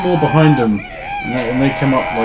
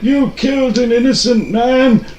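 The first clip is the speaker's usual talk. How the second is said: higher, slow indignant accusation; indignant priest; 8 semitones higher, slower than usual. Acted. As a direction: accuse them like a wronged priest.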